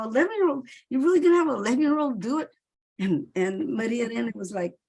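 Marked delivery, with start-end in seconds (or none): 0.0-2.5 quoted disbelief, higher, louder and fast; mock-alarmed at casting a child; incredulous retelling. To the director about an eleven-year-old, incredulous and fast. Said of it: "and I go".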